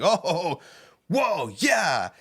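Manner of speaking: high-pitched mock exclamations; hammed-up whoa at the screen; horror-scene reaction. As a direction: act out reacting to gore, way up high.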